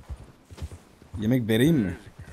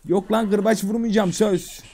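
put-on high, slow reassuring baby-talk; coaxing pet talk; 6 semitones higher, slower than usual. Player reassuring the horse, high coaxing voice. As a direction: coax a wary animal with a promise.

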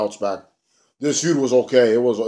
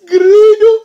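very high, loud squealed name; high delighted shriek; 11 dB louder than usual. Pretend. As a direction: shriek the name like a crowd pop. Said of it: "repeated reveal shout".

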